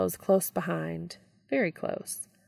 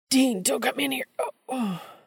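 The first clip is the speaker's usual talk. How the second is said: pitched-up pained plea; strained cry; 7 semitones higher than usual. Acted. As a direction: choke off at the end. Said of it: "Sammy cries ... choking sound".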